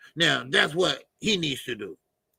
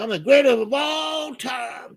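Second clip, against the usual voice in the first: high, drawn-out mock proclamation; imitated boast; 7 semitones higher, 3 dB louder than usual. Streamer quoting the fighter to his chatter, imitated boast. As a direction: crow it slowly, imitating a boastful champion. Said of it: "You know, he said".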